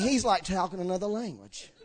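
ordinary delivery

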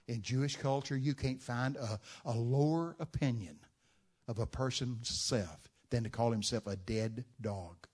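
normal talking voice

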